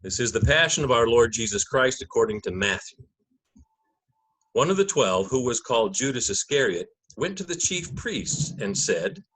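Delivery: measured, solemn Gospel narration; liturgical reading. Acted. Narrator opening the Passion for the congregation, solemn reading. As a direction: read it like Scripture from the lectern.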